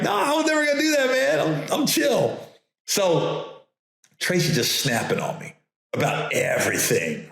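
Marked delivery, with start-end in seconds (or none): 0.0-2.4 high, fast, insisting he meant no harm; no, no, I'm chill, man; defensive protest. Retold or imitated. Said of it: "And I was like".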